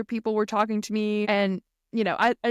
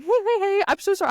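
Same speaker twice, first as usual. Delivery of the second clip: calm her down gently, pitched up high; soothing husband; 9 semitones higher, 3 dB louder, faster than usual. Retold or imitated. Husband apologizing to his frightened wife, mock soothing. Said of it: "Wesley was like".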